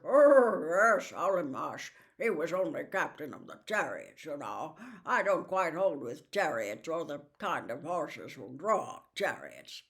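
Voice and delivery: talking warhorse, lofty and name-dropping; a vain old cavalry horse showing off; Bree the proud horse, boastful. Acted.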